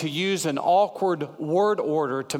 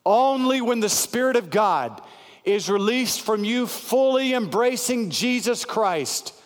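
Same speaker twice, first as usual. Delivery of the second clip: building sermon, pitched higher and rising; preaching; 6 semitones higher than usual. Acted. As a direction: lift the congregation toward the altar call.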